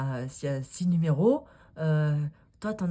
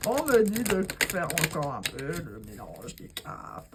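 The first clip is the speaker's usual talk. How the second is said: normal talking voice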